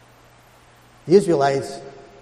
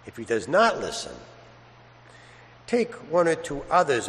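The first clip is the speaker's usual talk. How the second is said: gospel reading; 4 semitones lower, 6 dB quieter than usual. Acted. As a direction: read it reverently, unhurried, to the congregation. Priest proclaims the Gospel.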